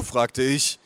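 ordinary delivery, nothing marked

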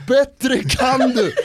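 high, slow, laughing yell; kid yelling. Retold or imitated. Kid heckles Zlatan, laughing yell. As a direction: yell it up at him through laughter. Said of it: "en annan unge skriker".

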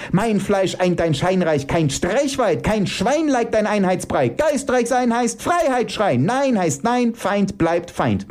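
high-pitched, impassioned tirade; shouting. Acted. Mike attacking the call for unity, shouting. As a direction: build into a high, defiant cry. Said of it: "schreit Mike".

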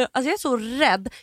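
ordinary talk

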